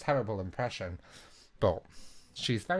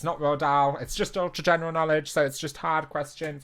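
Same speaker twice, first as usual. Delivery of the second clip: louder, quick announcer push; loud high announcement; 6 semitones higher, 6 dB louder than usual. Shown as a demonstration. Rereading cut-off intro louder.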